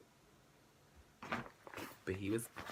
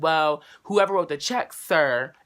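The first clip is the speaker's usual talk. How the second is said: rude bank clerk; 4 semitones higher, 6 dB louder, slower than usual. Retold or imitated. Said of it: "getting rude as shit".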